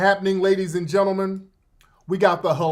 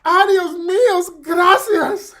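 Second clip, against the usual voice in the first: Zoe Saldana; 9 semitones higher, 5 dB louder, slower than usual. Retold or imitated. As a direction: gush an acceptance speech in a hammed-up Spanish accent. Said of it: "she had the accent and everything".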